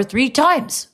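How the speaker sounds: three times with three lifted high and firm; stress on three. Acted. Mama insisting the dog lick three times, stressing three. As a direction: hit three times firmly.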